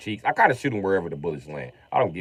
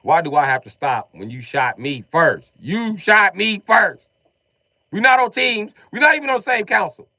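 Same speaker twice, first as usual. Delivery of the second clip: loud indignant protest; indignant yelling; 10 semitones higher, 9 dB louder than usual. Retold or imitated. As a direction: yell back at the kid. Arguing with son.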